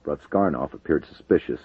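ordinary delivery, nothing marked

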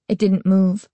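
usual speaking voice

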